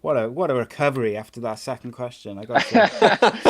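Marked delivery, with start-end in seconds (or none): 2.4-3.5 laughing gloat. Real, not acted. Host gloating after tricky question.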